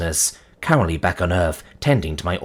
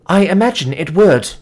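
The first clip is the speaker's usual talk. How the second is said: high, louder, taken-aback reply; alarmed; 9 semitones higher, 5 dB louder than usual. A narrator's reading. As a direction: let alarm lift the voice.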